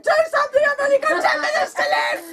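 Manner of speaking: high, loud, fast panicked shouting; frantic screaming. Retold or imitated. Wife begging husband for help, fast frantic scream. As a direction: rattle it off in terror. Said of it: "I screamed".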